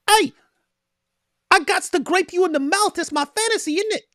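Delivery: very high, put-on giddy voice; mock giddy debater. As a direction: squeal it like a blissful fool. Voicing Ali Dawa's fantasy.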